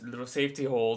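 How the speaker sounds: normal talking voice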